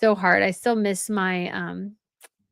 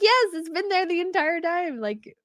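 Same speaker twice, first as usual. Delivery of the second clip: mock exasperated reply; 9 semitones higher than usual. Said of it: "I was like".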